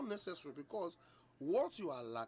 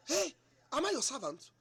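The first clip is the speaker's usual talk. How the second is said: indignant retort; 10 semitones higher, 9 dB louder than usual. Retold or imitated. Wife refusing to clean for her husband, loud and indignant. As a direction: fire it back offended, then drag the repeat.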